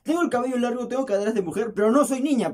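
high, loud comic indignation; indignant protest. Retold or imitated. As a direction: protest loudly, acting offended. Indignant protest at being called girl. (protesta indignada, aguda y fuerte)

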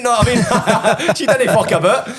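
real laughter breaking through the line; laughing. Real, not acted. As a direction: chuckle it out, amused at how fast she moved. Laughing about the pregnancy.

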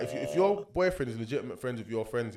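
normal talking voice

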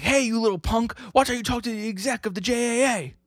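very high, squawking mock outrage; indignant scolding. Executive scolding a cheeky kid, mock indignant. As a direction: tell off a punk in a high, hammed-up voice.

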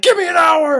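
high, very loud shout; full-voice screamed demand; amped-up yell. Retold or imitated. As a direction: re-enact yelling at intruders to back off. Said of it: "I yelled at these two girls".

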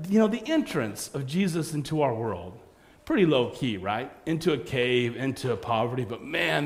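ordinary talk

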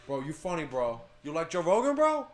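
loud, high, excited bro voice; pushy college bro hyping podcasts; frat bro. Retold or imitated.

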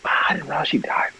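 hushed, stunned whisper. Real, not acted. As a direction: whisper it in shock. Surprised at actress's death.

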